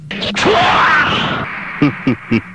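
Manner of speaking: breathy mocking villain laugh; scornful unvoiced chuckle.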